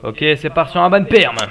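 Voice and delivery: shouted, pitch jumping high; loud announcement. Real, not acted. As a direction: call out the ban loudly, rising. Déclenche un bannissement après silence. (annonce criée, voix qui monte)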